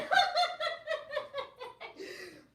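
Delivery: high, falling laugh that trails off; can't stop laughing; long laugh. Real, not acted. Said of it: "Y'all got me cracking up here".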